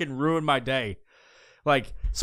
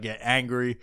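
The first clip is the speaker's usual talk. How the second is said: ordinary talk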